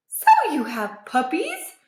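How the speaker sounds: lightly voiced surprise; a touch of hopeful disbelief; amazed. A narrator's reading.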